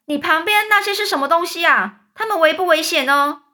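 high loud question; high loud wary call; shouting. A narrator's reading. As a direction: yell up the question. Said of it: "警长大叫说".

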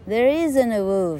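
plain reading voice

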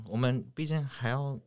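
ordinary talk, nothing marked

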